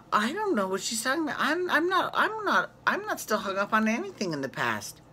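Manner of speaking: high, fast, flustered Sonja denial; hammed-up Sonja protesting; imitating Sonja. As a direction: squeak it out like Sonja caught off guard. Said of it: "She's like".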